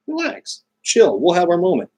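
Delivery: high, coaxing words to a dog; calm the excited pup, up high; pet voice. Real, not acted. Soothing restless dog.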